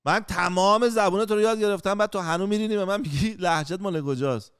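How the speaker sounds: mild mock outrage; retold indignant retort. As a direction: throw it back in his face. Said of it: "گفتم".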